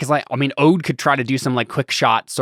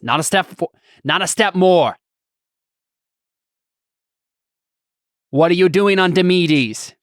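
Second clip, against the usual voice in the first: put-on loud, high, threatening Cockney accent; threatening shout; 6 semitones higher, 4 dB louder than usual. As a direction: bark it at them, gun raised. GM voices hostage-taker threatening.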